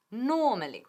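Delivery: "Normally" with stress on NO, then falling away; "Normally" as NO-ma-li, first syllable strongest. Shown as a demonstration. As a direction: hit the NO of "Normally", r silent. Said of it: "El acento recae en la primera sílaba".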